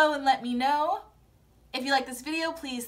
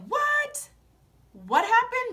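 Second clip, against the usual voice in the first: high, loud, astonished questions; mock shock; 9 semitones higher, 3 dB louder than usual. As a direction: act out someone's stunned disbelief, high and loud. Retelling Rachel's shocked reaction.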